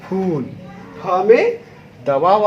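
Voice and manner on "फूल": फूल with weight on the long ऊ; stressed demo. Shown as a demonstration. Teacher showing pupils the long ऊ in फूल. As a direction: lean into फू in फूल. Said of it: "फूल बोलिए".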